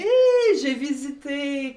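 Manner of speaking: high-pitched burst of delight; excited reminiscence. Real, not acted. Co-host telling a friend she visited Lausanne, real excitement. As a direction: squeal it high, then ease down.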